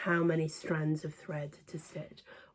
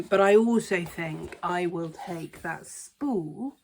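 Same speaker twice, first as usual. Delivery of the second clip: ordinary delivery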